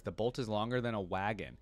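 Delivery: ordinary delivery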